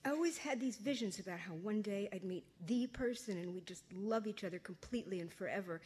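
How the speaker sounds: reading her novel aloud to an audience; story narration. A narrator's reading. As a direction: tell it like a teenage diary.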